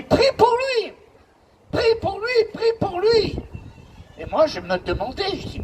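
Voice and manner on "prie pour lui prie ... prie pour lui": high, pressing cry repeated; a father pleading urgently; desperate plea. Retold or imitated. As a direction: beg it, voice pitched way up. Father begging pastor to pray.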